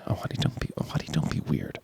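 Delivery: soft breathy whisper; whispered quote. Retold or imitated. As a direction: whisper the line. Whispered movie line.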